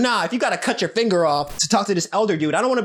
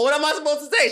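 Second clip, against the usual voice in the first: laughing; 8 semitones higher than usual. Real, not acted. Laughing repeat after giggle.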